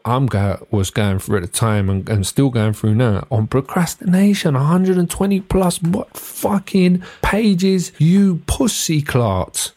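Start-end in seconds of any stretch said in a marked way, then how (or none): 3.5-9.7 high-pitched, drawn-out exasperated swearing; fed up and incredulous; exasperated rant. Retold or imitated. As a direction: stretch out each indignant word, pitched high. Ranting at a book given him.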